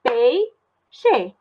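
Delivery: "peixe" in two parts, pei... xe; teacher's syllable demo of "peixe". Shown as a demonstration. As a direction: slowly separate "peixe" after the diphthong. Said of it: "eu separei".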